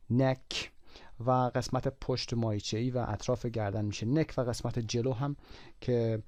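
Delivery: usual speaking voice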